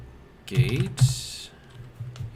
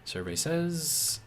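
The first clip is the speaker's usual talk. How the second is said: game show host; 7 semitones higher, much slower than usual. Pretend. Game show reveal catchphrase.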